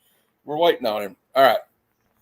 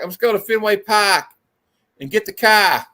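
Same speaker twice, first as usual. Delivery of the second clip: bad, raised Boston accent; amateur mock Boston accent; 5 semitones higher than usual. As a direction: have a rough go at Bostonian. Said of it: "I had a friend from Boston".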